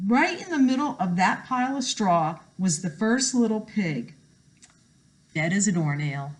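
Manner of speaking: ordinary delivery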